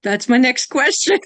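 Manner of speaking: delighted, laugh bursting out at the end; real amusement. Real, not acted. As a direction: say it loudly through rising laughter. Amused at guest guessing next question.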